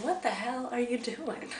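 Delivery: a quiet aside of disbelief; inner thought, under breath. Retold or imitated. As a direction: say it to yourself, soft and incredulous. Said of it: "and I'm thinking".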